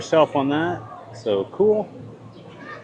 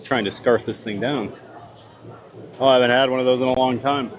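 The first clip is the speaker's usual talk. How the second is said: ordinary talk, nothing marked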